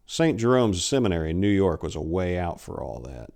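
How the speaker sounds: ordinary delivery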